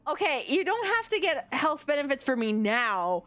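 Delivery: ordinary talk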